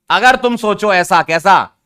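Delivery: usual speaking voice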